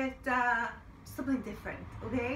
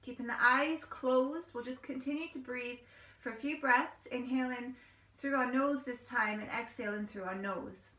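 calm, steady breathing cues; guided meditation. Acted.

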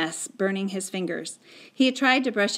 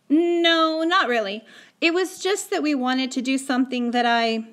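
put-on higher boy's voice, starting louder; defensive excuse; 5 semitones higher, 4 dB louder than usual. Boy excusing the thumps to Mum, defensive. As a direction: deny it, then explain and break off. Boy explains himself to mother.

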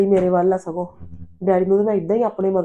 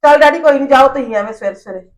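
loud pleading; 4 semitones higher, 5 dB louder than usual. Acted. Daughter shoos father off to work.

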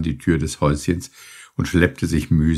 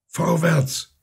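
calling out; 10 semitones higher than usual. A narrator's reading. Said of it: "rief Penelon".

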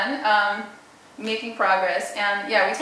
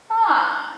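smooth high glide; 13 semitones higher, 7 dB louder than usual. Shown as a demonstration. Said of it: "nice smooth glide".

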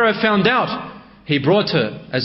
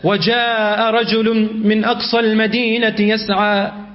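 quick chanted verse; Quran recitation; 5 semitones higher, much faster than usual. Acted. Preacher quotes Surah Yasin to the congregation, chanted. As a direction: recite it in rapid cantillation.

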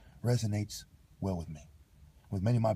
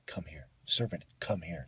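mock master's order; 5 semitones lower, 5 dB quieter than usual. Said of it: "When you tell someone".

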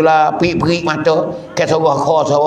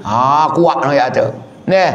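ordinary talk, nothing marked

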